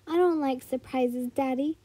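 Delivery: ordinary delivery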